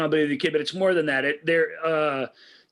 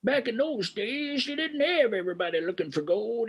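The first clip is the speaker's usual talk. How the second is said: put-on old prospector; 9 semitones higher than usual. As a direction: ramble like a grizzled old miner. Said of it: "That's my old prospector voice".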